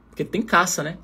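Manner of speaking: ordinary delivery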